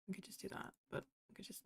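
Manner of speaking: unvoiced whispered muttering; whispering. Real, not acted. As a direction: whisper it to yourself. Muttering to herself mid-task.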